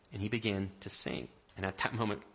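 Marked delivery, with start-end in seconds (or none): none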